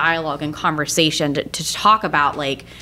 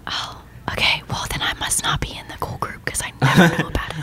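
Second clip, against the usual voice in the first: quick hushed whisper; giggly prank whisper; 2 semitones lower, 4 dB quieter, faster than usual. Pretend. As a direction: whisper back playfully. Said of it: "Stop whispering into the mic".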